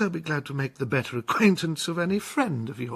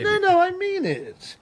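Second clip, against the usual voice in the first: insistent; 12 semitones higher than usual. Acted. Captain insists, flattering.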